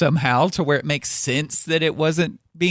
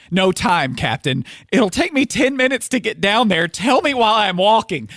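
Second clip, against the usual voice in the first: higher and louder, pushing the urgency; hammed-up urgent engineer; 8 semitones higher, 4 dB louder than usual. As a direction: play it as an engineer who insists on stalling. Acting out comic engineer dialogue.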